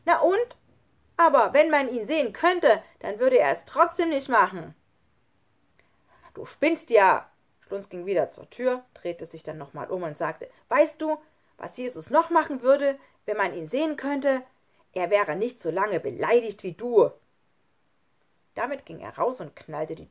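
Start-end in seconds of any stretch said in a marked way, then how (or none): 0.0-4.7 high, loud comeback; defiant anger. A narrator's reading. Lukas defends Jesus to Schlunz, defiant. 6.3-7.3 loud, scornful jab; angry dismissal. A narrator's reading. Schlunz gives up arguing with Lukas, angry. 10.7-17.1 loud, raised reproach; heated parting shot. A narrator's reading. Schlunz turns at the door, reproachful.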